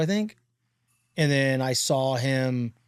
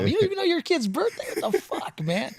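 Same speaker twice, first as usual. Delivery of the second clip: much higher, incredulous squeal; mock outrage. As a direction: squeak out the disbelief at dad. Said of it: "I'm like".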